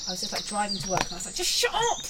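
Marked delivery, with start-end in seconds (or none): 1.3-2.0 high, loud exasperated yell; angry yelling. Retold or imitated. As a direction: snap at the flowers in frustration. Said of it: "just getting really angry".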